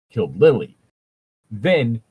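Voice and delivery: ordinary talk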